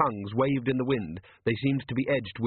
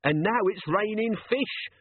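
high-pitched despairing moan; 10 semitones higher than usual. A narrator's reading. Captain lamenting the raining fish, high moan. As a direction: complain plaintively, pitched right up. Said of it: "Jenkins moaned".

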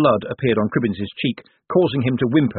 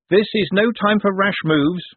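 raised, high, deliberate warning; said loudly; 10 semitones higher, 3 dB louder than usual. A narrator's reading. Moist signals to the shadow in the fog, loudly. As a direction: project it slowly so others can hear. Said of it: "he said loudly".

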